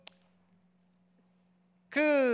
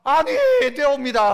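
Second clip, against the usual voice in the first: objecting minister; 7 dB louder, slower than usual. Acted. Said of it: "하는 사람이 있어요".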